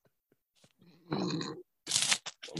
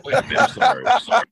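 high, louder mock correction; sudden realization; 5 dB louder, faster than usual. Friend realizing his mistake, high and louder. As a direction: catch yourself in a squeaky panic.

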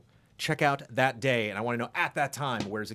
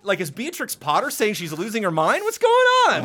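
rising to a very high loud squawk of confusion; mock bewilderment; 8 semitones higher, 6 dB louder than usual. Storyteller acting stunned at the vanished house, high and loud. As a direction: build from puzzled to shrill disbelief. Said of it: "And it's like".

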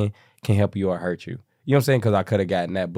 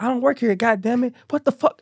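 high, angry mock outburst of an old lady; hammed-up furious old woman; 14 semitones higher than usual. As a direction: squeal it out like an offended church lady. Old lady's angry outburst retold.

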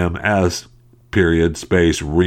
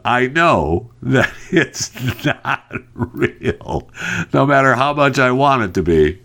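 laughing; 8 semitones higher than usual. Real, not acted. Laughing at the scam emails.

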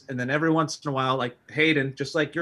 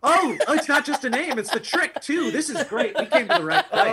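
high, delighted audience member; excited; 12 semitones higher, 4 dB louder than usual. Pretend. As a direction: gush like a thrilled fan. Excited crowd member at drag show.

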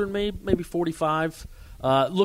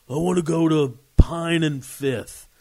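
put-on slurred drunk; 4 semitones higher, 5 dB louder, slower than usual. Drunk man voiced in story.

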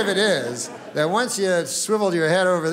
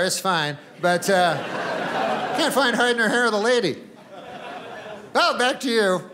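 raised, chirpy on-the-spot reporter; mock-cheerful live report from outside; field reporter; 3 semitones higher than usual. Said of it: "guy outside, and he's like".

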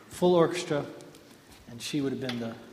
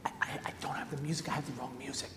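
panicked whisper; 2 semitones lower, 11 dB quieter, faster than usual. Retold or imitated. Pianist confesses to conductor mid-concert.

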